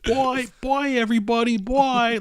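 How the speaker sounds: high, loud sing-song farewell; sing-song old lady. Retold or imitated. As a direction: wave goodbye in a high chirp. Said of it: "she's like".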